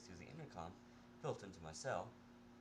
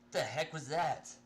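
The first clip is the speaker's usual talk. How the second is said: startled; 7 semitones higher, 9 dB louder, slower than usual. Acted. Dazed after crash.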